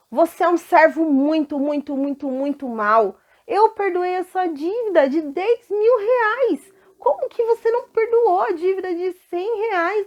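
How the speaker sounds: loud, high scolding of the servant; angry king rebuking. Acted. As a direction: let the verdict ring out furiously. King condemns the wicked servant. (rei furioso repreendendo o servo mau, em voz alta)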